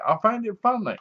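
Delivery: ordinary talk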